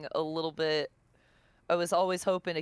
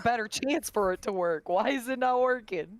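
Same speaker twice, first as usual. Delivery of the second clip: higher-pitched flustered pleading; mildly exasperated, voice rising in pitch; retold fluster; 7 semitones higher than usual. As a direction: act out your own baffled frustration. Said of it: "I was getting flustered".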